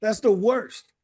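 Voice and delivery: ordinary delivery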